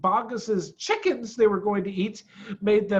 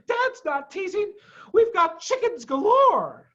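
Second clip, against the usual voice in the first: very high, bouncy cub voices; bragging with delight; excited fox cubs; 6 dB louder than usual. A narrator's reading. Fox cubs reassuring Badger, excited.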